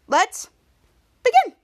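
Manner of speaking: big hyped-up launch into the recap; excited build. Real, not acted. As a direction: build to a loud high burst on begin. Hype opener to start recap.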